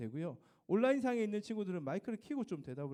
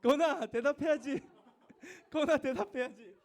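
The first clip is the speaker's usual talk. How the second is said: laughing coax; 8 semitones higher, 6 dB louder than usual. Real, not acted. Teacher laughingly urging a student to answer roll call.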